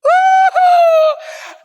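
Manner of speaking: high, loud hammed-up bawl; mock wailing cry. Emperor bawling at the world's end, hammed-up wail. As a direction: imitate a long wordless wail of weeping. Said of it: "Iskandar nangis".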